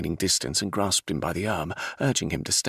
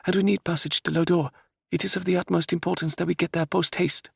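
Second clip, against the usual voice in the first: raised princess voice, pressing and urgent; 12 semitones higher than usual. Acted. Princess insists on passage to Lodore, urgent. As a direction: insist like a determined young royal.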